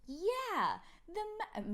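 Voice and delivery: put-on a small child jumping in; imitating child. Mother imitating her kid, eager child voice.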